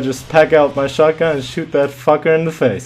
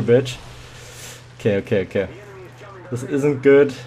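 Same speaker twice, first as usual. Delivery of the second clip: normal talking voice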